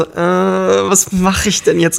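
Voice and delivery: high, helpless, overwhelmed voice. Retold or imitated. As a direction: sound completely lost and overwhelmed. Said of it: "vollkommen überfordert".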